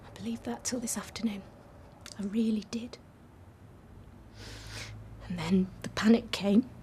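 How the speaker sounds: breathy whisper, almost gone. Acted. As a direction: hush it out in fear. Confessing fear, voice drops away.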